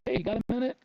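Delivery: high, eager mock kid voice; imitating the kid. The kid hurrying across the street to ask, eager mock voice. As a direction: toss it off like a nervous young guy. Kid hustling over, asking for help.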